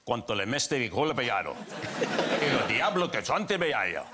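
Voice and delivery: light mock Spanish dub voice; lightly hammed-up Spanish dubbing voice; sexy Spanish voice. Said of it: "very sexy Spanish voice".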